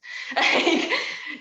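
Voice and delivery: breathy laugh. Real, not acted. Laughs at own point.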